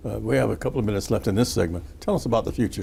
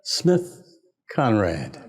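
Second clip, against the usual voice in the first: higher-pitched, formal announcer read; radio feature read; 3 semitones higher, 4 dB louder than usual. Acted. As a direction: read out a tribute with measured pauses before names. Host reads prepared history feature.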